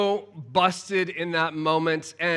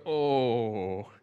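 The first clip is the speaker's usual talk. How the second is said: sinking oh; 7 semitones lower, 6 dB quieter than usual. Retold or imitated. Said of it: "felt my stomach go".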